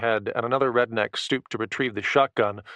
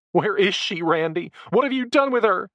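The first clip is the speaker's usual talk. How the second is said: high, loud frantic pleading; desperate demand; 9 semitones higher, 3 dB louder than usual. A narrator's reading. Jessica demanding Randy return her daughter, high and loud. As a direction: cry out for the child.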